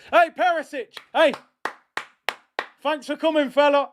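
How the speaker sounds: high, jeering send-off shouts; mocking farewell. Real, not acted. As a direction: wave the rival off with glee. Taunting substituted rival players.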